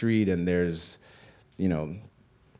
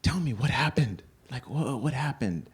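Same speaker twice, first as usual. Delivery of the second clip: mother's gentle pleading; 6 semitones higher than usual. Retold or imitated. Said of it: "she was like".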